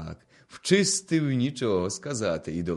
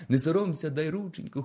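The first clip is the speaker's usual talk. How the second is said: plain reading voice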